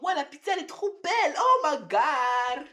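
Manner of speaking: high-pitched fake gushing; mocking turncoat fans. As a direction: mimic fickle fans gushing, ending drawn out. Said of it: "faut pas retourner sa veste et dire".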